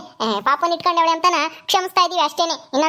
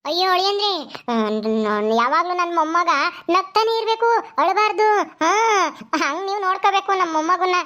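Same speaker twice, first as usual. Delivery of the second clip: doting mother-in-law; 2 semitones higher than usual. Acted. (ಮೊಮ್ಮಗನ ಮುದ್ದಿಸುವ ಅತ್ತೆಯ ಧ್ವನಿ)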